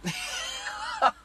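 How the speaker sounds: a high, exasperated shout of laughter; high screaming laugh at the absurdity; shrieking laugh. Real, not acted. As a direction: let out a wild yelp of a laugh. Bursts out at own bad luck.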